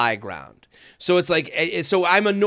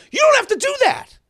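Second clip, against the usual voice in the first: much higher, blurted retort; high mock exclamation; 4 dB louder than usual. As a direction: shoot it out high and loud. Retelling his blunt reply.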